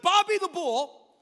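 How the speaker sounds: high, loud, slow hype-man call; booming arena intro; announcer hype. Acted. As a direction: announce him like a wrestler. Dramatic nickname reveal.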